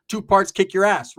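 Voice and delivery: ordinary talk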